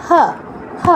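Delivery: হ as Bengali h with a short open a; Sanskrit ha. Shown as a demonstration. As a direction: say হ as "ha", not "ho". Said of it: "হ এর উচ্চারণ বাংলার মতোই".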